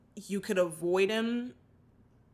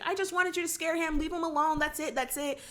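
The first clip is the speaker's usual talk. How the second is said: put-on higher girl voice; 6 semitones higher than usual. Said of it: "was just like".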